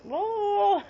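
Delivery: a high, bright burst of wonder; excited whoa. Real, not acted. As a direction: let the whoa fly up high. Describing her racing mind.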